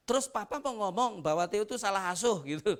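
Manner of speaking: loud, high indignant challenge; angry wife. Retold or imitated. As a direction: throw the question back at him in anger. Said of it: "Dia marah ya". (istri menantang dengan marah dan keras)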